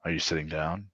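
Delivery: soft, low-key warning; a quiet serious heads-up; hushed agent. Retold or imitated. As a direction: play it down like bad news is coming. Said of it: "and he's like".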